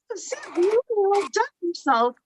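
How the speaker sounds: lightly voiced mother-in-law, high and sweet; a hint of the polite in-law praising her. Retelling her mother-in-law's polite praise of the pie, high and sweet. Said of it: "And then she goes".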